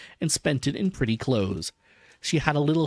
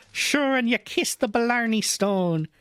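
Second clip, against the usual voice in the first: high, slow Irish lilt; teasing Irish put-down, pitched well up; scoffing Irish accent; 8 semitones higher than usual. A narrator's reading. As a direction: scoff it in a playful Irish brogue. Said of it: "she scoffed in an Irish accent".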